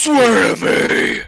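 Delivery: loud, drawn-out mock menacing roar; Batman. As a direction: roar it slowly, imitating a terrifying interrogator. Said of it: "And he's like".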